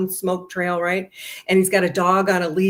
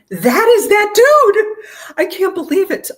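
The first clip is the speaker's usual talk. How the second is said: much higher and louder, slow shocked cry; stunned disbelief; 13 semitones higher, 11 dB louder, slower than usual. Retold or imitated. Telling Linda the date is riding past, shocked and loud. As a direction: gasp it out like you've seen a ghost.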